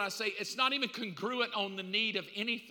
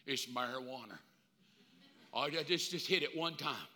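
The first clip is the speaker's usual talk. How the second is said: lower, softer coaxing voice; pushy friend; 8 semitones lower, 7 dB quieter than usual. Acted. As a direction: lure him in, low and easy. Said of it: "the guy that says, "Dude".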